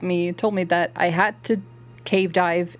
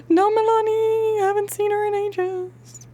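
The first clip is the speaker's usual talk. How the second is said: high mock wail; 12 semitones higher than usual. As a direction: jump up high in mock dismay. High mock lament, no Milani.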